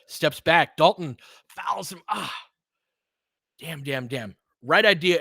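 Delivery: ordinary talk, nothing marked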